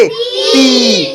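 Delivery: ती with a held "ee"; ती, sustained as a teacher's model; drawn-out vowel. Shown as a demonstration. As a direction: stretch the vowel of ती. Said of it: "थोड़ा खींच कर बोलेंगे".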